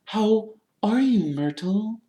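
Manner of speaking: high, slow forced cheer; falsely bright Hermione. A narrator's reading. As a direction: greet with fake friendliness, pitched high. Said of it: "in a falsely bright voice".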